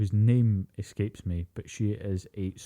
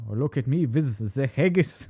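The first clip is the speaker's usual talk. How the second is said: put-on higher, German accent failing at Scottish; German-tinged Scottish attempt; 4 semitones higher than usual. German friend at a house party, German-accented Scottish attempt. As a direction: let a German accent take over the Scottish. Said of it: "still let the German go through".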